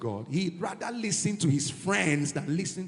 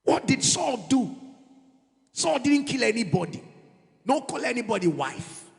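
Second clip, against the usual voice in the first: higher, louder preaching; 6 semitones higher, 3 dB louder than usual. Acted. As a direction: raise the voice to the congregation. Preaching at full force.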